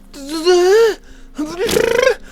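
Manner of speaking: high strangled noise of exasperation; frustrated groan. Real, not acted. Customer lost for words over the refund, exasperated groan. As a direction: strain out a wordless cry of frustration.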